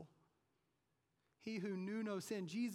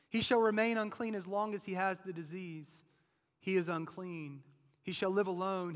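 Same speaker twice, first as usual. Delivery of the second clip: full-force preaching; 2 semitones higher, 10 dB louder than usual. Acted. Preacher to congregation about the leper's isolation, full-force preaching.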